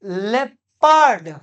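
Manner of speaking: लेपर्ड stretched into two parts, "LEP–paard", very slowly; correct pronunciation. Shown as a demonstration. Teacher models for students, लेपर्ड drawn out as "lep-pard". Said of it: "इसे हम पढ़ते हैं".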